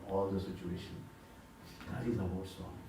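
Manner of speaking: ordinary delivery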